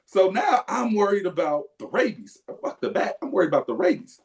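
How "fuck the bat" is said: ordinary talk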